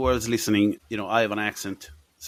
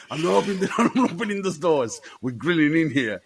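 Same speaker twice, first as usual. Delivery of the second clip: laughing mock refusal; 9 semitones higher, 3 dB louder than usual. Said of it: "dress up as a pizza boy".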